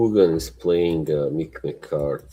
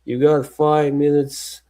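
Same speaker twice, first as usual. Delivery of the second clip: raised-pitch manager's warning; Brian Clough; 8 semitones higher than usual. Retold or imitated. Manager to goalkeeper in the corridor, imitated warning. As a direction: give a mock countdown warning. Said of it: "He's like".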